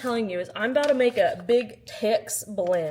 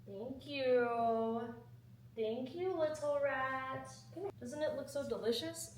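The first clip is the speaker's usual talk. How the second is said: soft pet talk; 3 semitones higher, 10 dB quieter than usual. Real, not acted.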